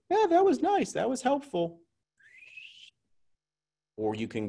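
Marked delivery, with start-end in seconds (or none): 0.1-1.8 much higher, airy, dismissive voice; mock indifference. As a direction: brush it off in a high, careless tone. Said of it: "You can either let what Megan showed us go".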